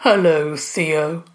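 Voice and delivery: lightly voiced, low greeting; a hint of a wise old monster; monster's calm greeting. A narrator's reading. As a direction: greet a waking friend in a low voice. Wise monster greets waking boy.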